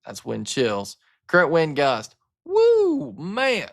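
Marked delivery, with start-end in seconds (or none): 2.4-3.7 high, loud amazed woo; excited whoop. Real, not acted. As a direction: whoop at the wind gust. Reacting to huge wind gust.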